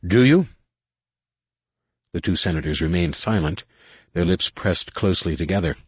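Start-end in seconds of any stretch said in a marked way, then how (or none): 0.1-0.5 put-on higher, louder drunken challenge; demanding shout. A narrator's reading. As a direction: bark it at the senators. Drunk Findian presses senators.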